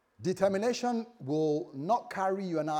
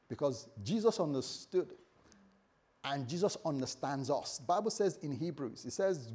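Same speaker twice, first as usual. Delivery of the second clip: ordinary delivery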